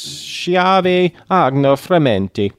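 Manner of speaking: loud, slow, hammy Italian accent; ridiculous Italian accent. Shown as a demonstration. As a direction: roll it out in a big Italian accent. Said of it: "ridiculous Italian accent".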